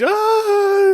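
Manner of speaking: short high mock scream of fright; retold scream. Lady panicking at the alien, a short high mock scream. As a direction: give a quick high scared shriek.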